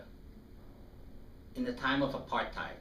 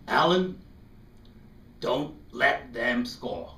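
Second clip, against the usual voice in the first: earnest encouragement; 7 dB louder, slower than usual. Retold or imitated. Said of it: "And he says".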